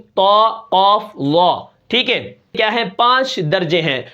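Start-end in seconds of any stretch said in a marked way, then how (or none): 0.0-1.0 slow, full-mouthed طا and قاف; heavy letters recited. Shown as a demonstration. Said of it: "ان کا مجموعہ کیا ہے".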